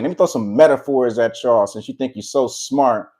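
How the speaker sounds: ordinary delivery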